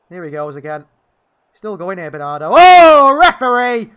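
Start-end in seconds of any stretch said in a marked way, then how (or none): 2.5-3.9 loud outraged yell at the ref; outraged shout. Real, not acted. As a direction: shout it at the referee in disbelief. Furious protest at a foul.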